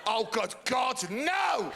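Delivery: slow rising cry of horror; mock anguished cry. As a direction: wail it, rising to no.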